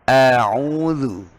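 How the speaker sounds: a'udzu with a steady open a; correct a'udzu. Shown as a demonstration. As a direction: keep the a plain in a'udzu. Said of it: "A, tetap a". (a tetap a)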